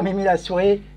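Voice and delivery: normal talking voice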